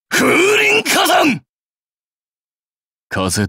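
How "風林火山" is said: very high, drawn-out shout; slow, full-force battle cry; special attack cry. Acted. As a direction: yell the move's name, stretching each part.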